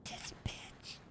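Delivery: breathy whisper of suspicion; whispered insult. Real, not acted. Streamer whispers at the traitor servant in the cutscene. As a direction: whisper it under your breath.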